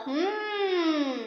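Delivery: ordinary talk, nothing marked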